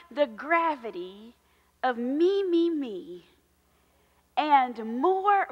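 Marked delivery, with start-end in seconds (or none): none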